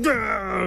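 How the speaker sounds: high strained gah; frustrated gah. Acted. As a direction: cry out in frustration. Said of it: "she went".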